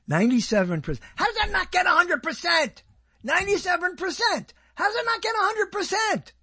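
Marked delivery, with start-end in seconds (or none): 1.2-2.8 high-pitched incredulous complaint; indignant disbelief. Real, not acted. Indignant at a show's score. 3.2-4.5 high, indignant repeat of the score; incredulous. Real, not acted. 4.7-6.3 high-pitched incredulous complaint; indignant disbelief. Real, not acted. Indignant at a show's score.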